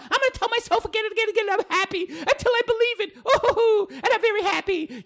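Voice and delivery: high, fast, laughing parody of her; mock woman. Mocking her self-talk, high, fast and giggly. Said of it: "doing the same thing with herself".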